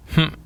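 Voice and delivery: "Hmm" choked off immediately by the throat; very brief, cut-off "Hmm"; dot tone, lips closed. Shown as a demonstration. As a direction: shut the throat straight after starting "Hmm". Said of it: "Your throat will close immediately".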